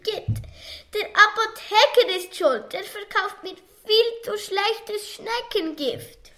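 high, whining plea; lightly voiced gardener shifting blame; wailing gardener. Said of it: "und jammerte".

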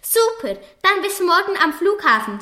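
high, eager exclaim; adventurous Oskar. Acted. Oskar agrees to the moon trip, high and eager. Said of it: "sagte Oskar unternehmungslustig".

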